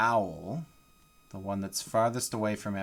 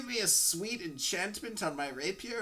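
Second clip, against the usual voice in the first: higher, soft pleading imitation; imitowany gracz; 9 semitones higher, 5 dB quieter than usual. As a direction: mimic a player asking a favor, softly. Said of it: "He's like".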